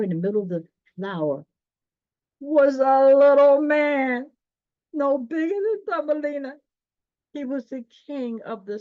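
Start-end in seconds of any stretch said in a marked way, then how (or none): 2.4-8.8 wondering narration. A narrator's reading. Reader marvelling at the tiny flower king. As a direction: reveal a marvel.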